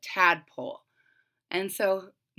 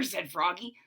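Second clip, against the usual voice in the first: plain reading voice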